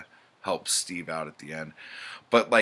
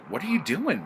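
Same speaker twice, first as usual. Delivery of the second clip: alarmed protest; 9 semitones higher, faster than usual. Retold or imitated. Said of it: "And Bert's like".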